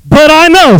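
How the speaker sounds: preaching the healed man's claim; bold declaration. Acted. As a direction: declare it boldly.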